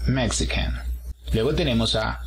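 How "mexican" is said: "Mexican" as MEK-si-can; stress on first syllable. Shown as a demonstration. Teacher models the nationality "Mexican", stress on first syllable. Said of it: "El apóstrofo al inicio de la palabra".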